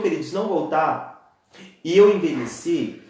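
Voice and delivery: ordinary talk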